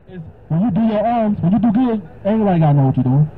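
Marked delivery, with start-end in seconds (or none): none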